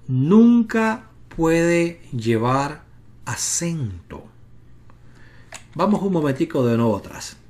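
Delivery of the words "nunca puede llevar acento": "nunca" slow and heavy, each word spaced out; stress on nunca. Shown as a demonstration. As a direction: lean hard on "nunca", drag the rest. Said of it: "Una sílaba que contenga una sheva". (recalca "nunca" despacio y con peso)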